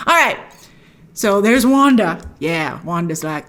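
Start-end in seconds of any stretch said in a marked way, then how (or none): none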